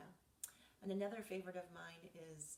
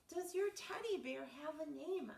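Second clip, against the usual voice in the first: louder and higher repeat; 10 semitones higher, 8 dB louder than usual. Acted. Asking Reggie again, said louder. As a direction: raise your voice, stress your. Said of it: "I said louder".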